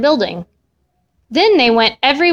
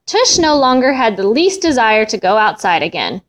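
ordinary delivery, nothing marked

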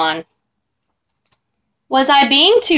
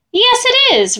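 a much higher, prim monster reply; high silky voice; 12 semitones higher than usual. Acted. Ralph proudly answers the boy in a high prim voice. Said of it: "high, silky voice".

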